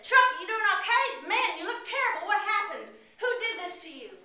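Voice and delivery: high, loud worried questioning; urgent concerned officer; alarmed cop. Acted. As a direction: call out to his wounded partner. Second cop finds partner.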